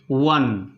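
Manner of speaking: "वन" said low, opening on a w sound; model pronunciation. Shown as a demonstration. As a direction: sound out "वन" as "wun". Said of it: "इसको हम क्या पढ़ते हैं".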